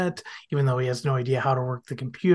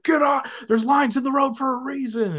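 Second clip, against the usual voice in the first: high mock road-rage shout; hammed-up screaming driver; imitated yelling; 10 semitones higher than usual. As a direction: yell at other drivers. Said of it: "he's yelling ... he's screaming".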